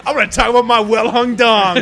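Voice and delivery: loud, hammed-up bragging; crude boasting. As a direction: blurt it out as a crass mock boast. Parodies how their chats go now.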